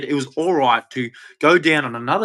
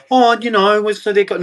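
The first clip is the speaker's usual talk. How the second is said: higher and louder, a whiny mock of the government; mocking politicians; 6 semitones higher, 4 dB louder than usual. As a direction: ape the politicians' excuses with scorn.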